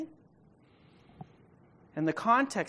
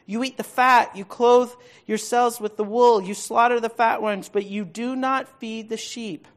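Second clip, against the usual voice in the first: higher, louder prophetic reading; preaching at full force; 5 semitones higher, 9 dB louder than usual. Acted. Pastor reading God's rebuke of Israel's shepherds, booming.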